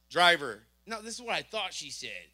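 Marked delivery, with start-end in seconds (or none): 0.1-0.6 police command. Retold or imitated. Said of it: "she goes".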